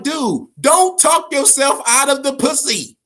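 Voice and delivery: high, loud, egging on a gamer; mock pep talk. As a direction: hype him up like a buddy shouting at the screen. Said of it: "I'm like".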